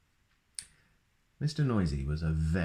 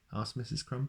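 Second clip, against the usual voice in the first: plain reading voice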